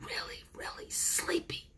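tired little rabbit whispering; sleepy whisper. Acted. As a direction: breathe it out, sleepy and small. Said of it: "All I can do is whisper".

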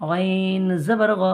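slow, drawn-out chant of the syllable; recitation drill. Shown as a demonstration. Teacher chants letter drills slowly for the class. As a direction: chant it slowly for students to copy. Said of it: "এটা অকার".

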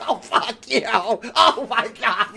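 drawn-out groan broken by laughs; exasperated laughing. Real, not acted. Player cursing the game after a near miss, exasperated laughing. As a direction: curse through helpless laughter.

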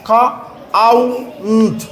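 "খ আউ উন্ট" in separate pieces with pauses; sounded out in parts. Shown as a demonstration. Teacher showing the ou sound in count, "খ আউ উন্ট" piece by piece. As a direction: split "কাউন্ট" into "খ", "আউ", "উন্ট". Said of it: "তাহলে কেমন উচ্চারণ হবে".

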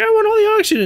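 very high, squeaky put-on voice; mock greedy muscles. Muscles demanding blood's oxygen, squeaky greedy voice. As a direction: squeal it like a greedy little cartoon creature. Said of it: "they'll be like".

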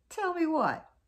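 eager demand. Acted. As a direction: demand it impatiently. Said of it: "And Snake said".

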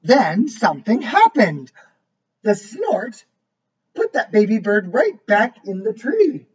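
lively storybook voice, each line set apart; reading to a child. A narrator's reading. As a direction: read it like a bedtime book for a little one. Reads picture book aloud.

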